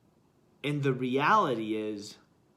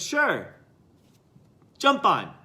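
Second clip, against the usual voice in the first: breezy monk; 7 semitones higher, 8 dB louder than usual. Acted. Second monk agrees readily.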